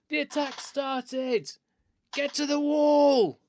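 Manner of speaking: high, drawn-out battle alarm shout; yelling at top of voice. Acted. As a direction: raise the alarm across a battlefield. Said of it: "yell at the top of his voice".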